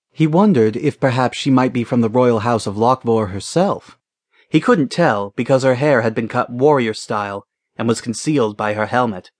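ordinary delivery

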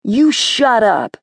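slow, pressed shout-down; tearful boy snapping back, slow; angry retort. Acted. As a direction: cut her off hard. Cole snaps at sister.

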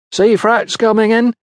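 high-pitched, carrying warning call. A narrator's reading. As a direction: call the news down from above. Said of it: "she called down to the other women below".

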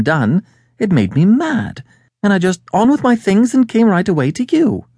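high, aggrieved lady's voice; indignant woman client. Acted. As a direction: complain indignantly, pitch jumping up. Voices Mary indignant at her father.

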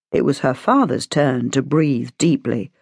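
plain reading voice

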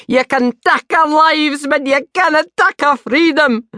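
rousing Scottish battle cry, hammed up; Braveheart Scottish. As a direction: teenager mock-declaiming in a Scottish accent. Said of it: "like being in that film, Braveheart".

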